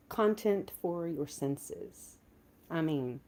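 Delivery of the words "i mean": ordinary talk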